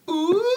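high, drawn-out mock audience ooh. Studio audience reacts to the kiss, teasing high ooh. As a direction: coo it like a scandalised sitcom crowd. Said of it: "noise in, in the audience goes".

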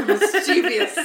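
laughing through the repeated name. Real, not acted. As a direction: chuckle while echoing the mistake. Mocking the wrong villain name.

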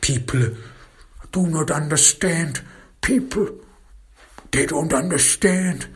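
high-pitched weepy self-pity; bursting into tears. Acted. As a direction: wail to be understood, as the tearful man. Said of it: "he again bursts into tears".

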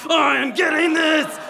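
loud, high, drawn-out shout; hammed-up triumphant yell; gleeful battle cry. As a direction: shout it with manic determination. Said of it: "like a squirrel to a nut, she's like".

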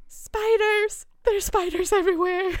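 high, loud panicked English shriek; retold screaming. Girls yell to their guide about spiders, panicked scream. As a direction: shriek it like you're covered in spiders. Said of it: "vi bara skrek".

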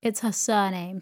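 usual speaking voice

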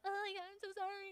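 high, soft gushing sympathy; mock sympathy. Preacher mocking the altar crowd, high gushing sympathy. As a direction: coo it like a fake comforter.

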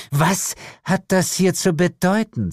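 high, tense hiss; hissed demand. A narrator's reading. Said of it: "zischte sie". (zischend zur Rede stellen)

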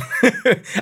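short burst of laughter; laughing. Real, not acted. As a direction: laugh out loud. Laughs at own joke.

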